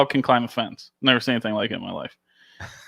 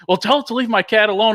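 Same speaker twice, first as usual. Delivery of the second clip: annoyed neighbor; 9 semitones higher, 3 dB louder, faster than usual. Retold or imitated. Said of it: "He's like".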